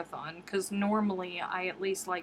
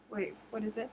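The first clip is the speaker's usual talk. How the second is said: ordinary delivery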